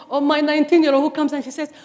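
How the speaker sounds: usual speaking voice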